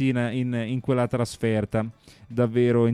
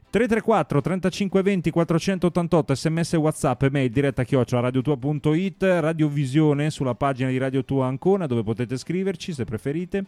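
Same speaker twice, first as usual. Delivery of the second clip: bright, raised announcer voice reading the number; upbeat radio host call-in read; 4 semitones higher than usual. Acted. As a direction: rattle off the number like a DJ. Announces contact details.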